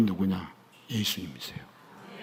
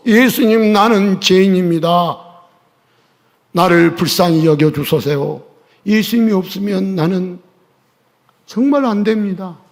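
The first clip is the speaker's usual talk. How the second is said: louder and higher, calling out to Jesus; loud crying-out prayer; 5 semitones higher, 8 dB louder than usual. Shown as a demonstration. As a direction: cry the prayer out with all your voice. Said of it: "이렇게 소리 질러 보십시오". (예수님께 크게 소리쳐 부르짖는 기도)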